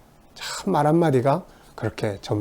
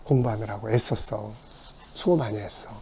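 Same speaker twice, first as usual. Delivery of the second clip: tender praise; 2 semitones lower, 7 dB quieter than usual. Retold or imitated. Praising a child's effort.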